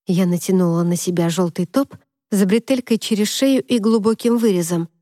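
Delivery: plain reading voice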